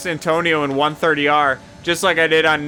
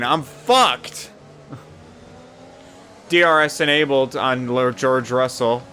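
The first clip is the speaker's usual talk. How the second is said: normal talking voice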